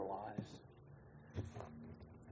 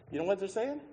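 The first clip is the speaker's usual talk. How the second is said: ordinary talk, nothing marked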